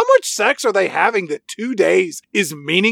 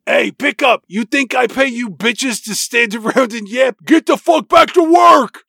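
high, loud barking yell that keeps climbing; yelling cook; 8 semitones higher, 4 dB louder than usual. Retold or imitated. Diner cook yells at the waitresses to get back to work, loud. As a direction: roar at the waitresses from the kitchen. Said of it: "he yells out".

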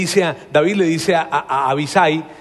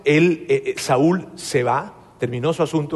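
ordinary talk

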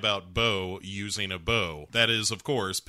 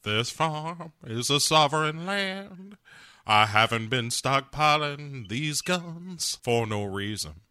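hammed-up Southern last-stand speech; Uncle Jesse. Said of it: "And Uncle Jesse says".

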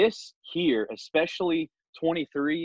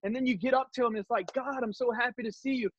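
pretend excitement; 8 semitones higher than usual. Imagined believer greeting God eagerly.

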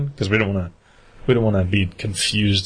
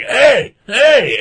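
much higher, louder mock call to a passer-by; drunk calling out; 14 semitones higher, 5 dB louder than usual. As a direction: holler at the jogger like the old drunk. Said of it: "and he was like".

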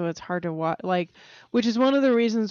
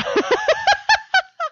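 squealing laugh; sudden high-pitched laugh; high burst of laughter; 6 dB louder than usual. Real, not acted. Laughs at own slip.

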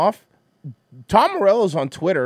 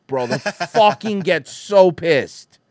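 normal talking voice

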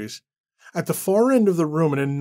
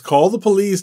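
louder, sarcastic echo of police; mocking echo; 5 semitones higher, 7 dB louder than usual. Randall mocking Annie, loud sarcastic police. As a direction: sneer the word police.

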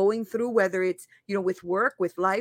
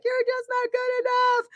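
put-on very high, small child's voice; inner child voice; 14 semitones higher than usual. Inner child squeaking at her grown self in a high voice. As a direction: squeak it out like a hurt little kid. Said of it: "my inner child starts kicking and screaming".

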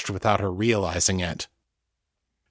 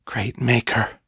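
hushed, understated exclamation; whispered; 2 semitones higher than usual. A narrator's reading. Mistress hearing the thralls' fate, mildly whispered. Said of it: "Miriam whispered".

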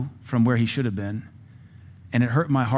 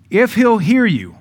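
preaching scripture; 5 dB louder, slower than usual. Acted. Scripture proclaimed at sermon's close.

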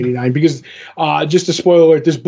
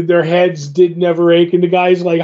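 normal talking voice

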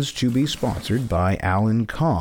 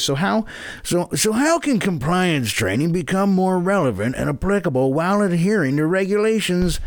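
newsreader voice; 6 semitones higher than usual. Shown as a demonstration. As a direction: read it like the evening news. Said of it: "That was just newsreader voice".

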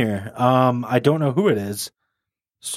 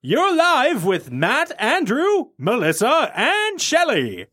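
high, sing-song announcer with stretched names; radio show host; 9 semitones higher, much slower than usual. Pretend. Host introducing co-hosts to a caller, hammed-up announcer. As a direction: present the panel like a big live broadcast.

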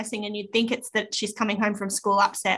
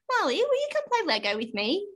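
put-on high sing-song child asking her sister; little girl first asking sister to play; whiny child; 9 semitones higher than usual. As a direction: plead sweetly like a small girl. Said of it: "in an appropriately whiny voice".

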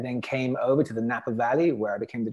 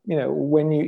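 ordinary delivery

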